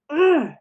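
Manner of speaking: high, loud frustrated "ugh"; a short burst of anger from the jaw; angry grunt. Shown as a demonstration. Teacher showing how anger sounds: loud grunt. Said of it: "I feel angry".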